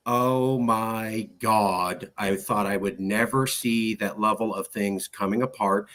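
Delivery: very slow, low acted disbelief; stunned dismay. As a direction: draw it out as if watching things fall apart. Imagined shock at TV event.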